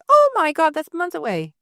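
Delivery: high, loud mock outcry, easing off; exasperated disbelief. Fan reacting to a distant release date, exasperated disbelief.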